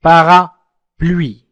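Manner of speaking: Parapluie stretched out very slowly, syllable by syllable; slow word model. Shown as a demonstration. Teacher isolates a word slowly. (نطق كلمة Parapluie ببطء شديد)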